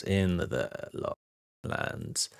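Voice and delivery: soft, breathy whispered repeat of the title; eerie whispered echo of a movie title; hushed echo. Pretend. Echoing the film title dramatically.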